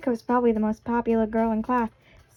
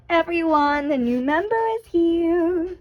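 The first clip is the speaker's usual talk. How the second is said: higher, drawn-out sing-song call; excited announcement; 5 semitones higher, slower than usual. Acted. Sayori announcing the new member to the club, excited. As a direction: call out brightly to the whole room, slowly.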